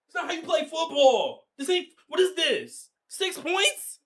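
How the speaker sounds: disbelieving, voice jumping high and slow; can't believe the six points scored; high, slow exasperated outburst. Real, not acted. Exasperated rant at team.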